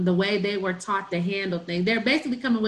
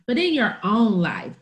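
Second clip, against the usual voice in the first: usual speaking voice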